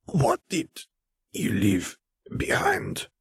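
high, then slow rasping question; rasped. Acted. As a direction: rasp the question out, dragging the end. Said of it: "Fer rasped".

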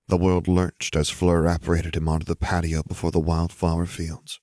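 plain reading voice

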